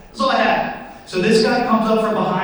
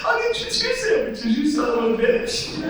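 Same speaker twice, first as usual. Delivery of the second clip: high-pitched mock crying; hammed-up tearful giving in, pitched way up; getting emotional; 8 semitones higher than usual. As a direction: blubber it in a high, overcome voice. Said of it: "I was, like, getting emotional".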